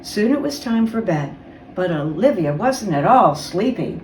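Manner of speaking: plain reading voice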